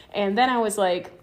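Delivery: ordinary talk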